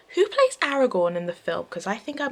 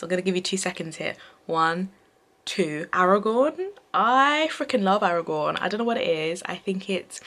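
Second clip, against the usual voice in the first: ordinary talk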